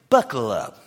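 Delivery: low, curt warning; understated, ominous order; stern warning from God. Acted. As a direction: drop the voice and warn him. God's paraphrased warning, acted.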